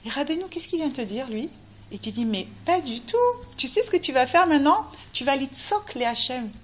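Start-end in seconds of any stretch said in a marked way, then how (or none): none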